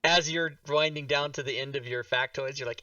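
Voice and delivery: ordinary talk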